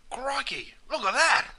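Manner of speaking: high-pitched Aussie amazement; high, astonished cry; surprised exclamation. Acted. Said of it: "it's used as an expression of surprise".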